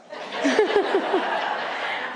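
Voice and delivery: a long breathy laugh at her own joke; airy laugh. Real, not acted. Laughing at her own line to the audience.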